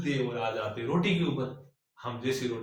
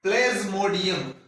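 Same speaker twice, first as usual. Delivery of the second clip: dictating; 4 semitones higher, 3 dB louder than usual. Shown as a demonstration. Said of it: "जो मैं यहां पे लिख रहा हूं".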